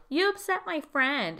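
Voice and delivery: high, slow mock scolding; indignant friend.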